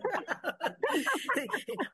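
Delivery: bright rising laugh. Real, not acted. Laughing while telling the host her face shows it. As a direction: laugh it off lightly.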